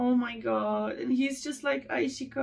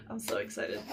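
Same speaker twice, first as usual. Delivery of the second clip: softer, hushed excitement; 9 dB quieter, faster than usual. Real, not acted. Whispering her excitement before opening the box. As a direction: say it under your breath.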